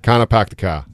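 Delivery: New England "kinda pack the ka", R's dropped; thick New England vowels, "ka" for car; New England accent. Shown as a demonstration. Guest demonstrating the phrase, New England accent. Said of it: "like that".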